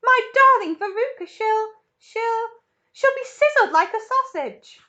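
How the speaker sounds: loud, high stammering wail; a mother imagining the worst; panicked yelling. Acted. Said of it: "yelled Missus Salt".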